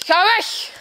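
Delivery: urgent outcry. Acted. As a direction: raise the pitch and push them away. Acted warning off attacker, escalating.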